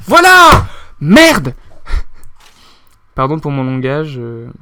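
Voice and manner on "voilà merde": sudden high yelp then curse; frustrated shout. Real, not acted. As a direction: yelp as the character dies. Cri après avoir perdu. (cri de frustration)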